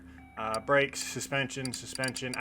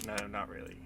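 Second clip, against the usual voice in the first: ordinary delivery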